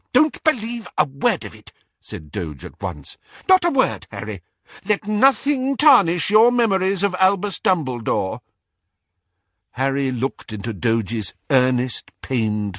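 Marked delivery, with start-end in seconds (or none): 0.1-1.7 higher, wheezy and indignant, rushing in places; indignant old wizard. A narrator's reading. Doge rants to Harry about Rita Skeeter, high and indignant. 3.5-8.4 higher, wheezy and indignant, rushing in places; indignant old wizard. A narrator's reading. Doge rants to Harry about Rita Skeeter, high and indignant.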